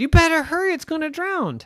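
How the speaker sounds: high, loud urgent chirp; urgent bird voice. Acted. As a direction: hurry them along. Bird urges bear.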